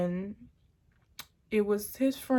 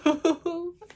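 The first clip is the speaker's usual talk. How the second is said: laugh breaking into a sob; 9 semitones higher, 4 dB louder than usual. Real, not acted. Laugh mixed with crying.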